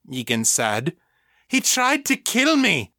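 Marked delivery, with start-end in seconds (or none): 1.5-2.9 very high-pitched, outraged cry; outraged protest. A narrator's reading. Mother to the man restraining her, outraged.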